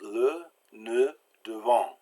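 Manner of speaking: le, ne, devant with short pursed-lip schwa; soft short E. Shown as a demonstration. Teacher repeating French examples, soft short E. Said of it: "real soft and short".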